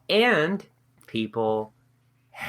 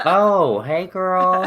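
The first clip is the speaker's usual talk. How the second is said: playful greeting; 8 semitones higher, much slower than usual. Pretend. Cheering a woman turning thirty.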